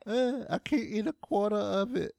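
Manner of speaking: high mock-protesting voice; Renee. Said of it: "Renee would have been like".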